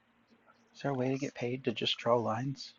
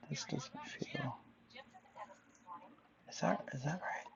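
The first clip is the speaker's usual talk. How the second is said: whispering; 4 semitones higher, 11 dB quieter than usual. Real, not acted. Doubting whisper to self.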